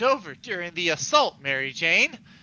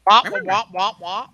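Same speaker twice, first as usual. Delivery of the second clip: wah wah noise; 3 semitones higher, 3 dB louder than usual. Retold or imitated. Mimicking shouting on phone.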